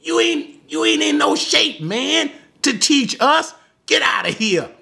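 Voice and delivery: high-pitched mock indignation, without shouting; offended Pharisees. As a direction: act out throwing an upstart out. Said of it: "They were absolutely offended in him".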